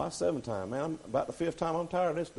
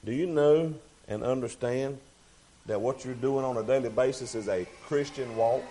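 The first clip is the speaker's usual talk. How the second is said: ordinary delivery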